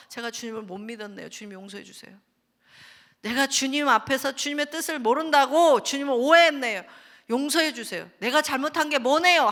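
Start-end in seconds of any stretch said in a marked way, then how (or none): 1.3-9.5 loud pleading for forgiveness; fervent repentance. Retold or imitated. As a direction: cry out for pardon. Acted repentance prayer. (크게 용서를 구하는 회개 기도)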